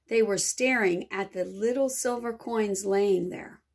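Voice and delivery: plain reading voice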